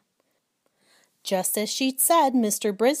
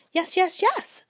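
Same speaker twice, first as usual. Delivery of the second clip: high, eager little squeaks; 10 semitones higher, 3 dB louder than usual. Acted. Hamster on his wheel calling for attention, in high eager squeaks. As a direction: chirp it out to get noticed.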